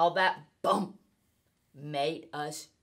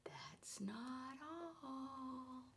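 whispered, slow and teasing; suspense whisper; 6 semitones higher, 22 dB quieter, much slower than usual. Acted. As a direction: hush it to build suspense.

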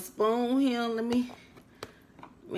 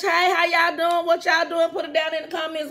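high, loud hello to viewers; excited greeting; 7 semitones higher, 3 dB louder than usual. Real, not acted. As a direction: greet them with a burst of joy.

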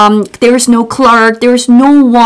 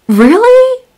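really squeaked very high in disbelief; surprised; 12 semitones higher than usual. Shown as a demonstration. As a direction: ask really with a shocked high rise. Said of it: "If you want to say really".

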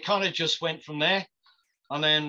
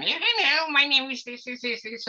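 much higher, goofy put-on voice; silly voice; 12 semitones higher than usual. Clowning for the casting crowd in a high silly voice. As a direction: clown it up for the room. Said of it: "go on all silly".

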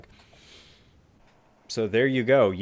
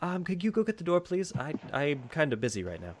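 higher, soft, polite request; busy wizard asking; 6 semitones higher, 6 dB quieter than usual. Acted. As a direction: hesitantly hand off a chore. Wizard asks gnome for favour.

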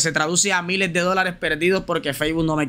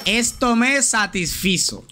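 higher, slow "Esto me satisfizo" with weight on "me"; teacher modelling the sentence slowly for chat; slow model sentence; 4 semitones higher, slower than usual. Shown as a demonstration. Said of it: "satisfací tampoco".

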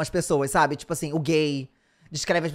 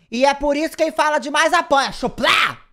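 gleeful taunt; 7 semitones higher, 5 dB louder than usual. Real, not acted.

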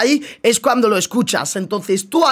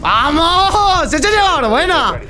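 loud, high victory cheer; excited cheer; 6 semitones higher, 3 dB louder, much slower than usual. Real, not acted. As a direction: cheer the round win. Celebrates winning the round.

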